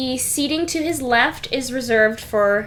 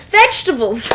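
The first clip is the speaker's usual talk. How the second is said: "Vegetables!" called out louder with a falling finish; loud exclamation; 6 dB louder, faster than usual. Acted. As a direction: announce "Vegetables!" triumphantly to the table. Duke repeats triumphantly.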